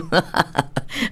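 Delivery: breathy, voiceless chuckle; real amused laugh. Real, not acted. Host still amused by being called aunt, chuckling. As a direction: laugh on until the voice drops out.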